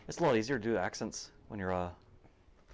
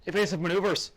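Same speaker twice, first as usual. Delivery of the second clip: shouting; 8 semitones higher, 7 dB louder than usual. Acted. Calling out as boat attacked.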